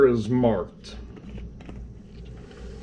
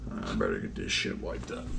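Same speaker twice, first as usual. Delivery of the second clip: quiet; 11 dB quieter than usual. Real, not acted. Muttered after leak.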